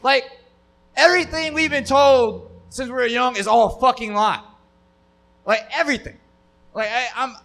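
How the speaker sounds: normal talking voice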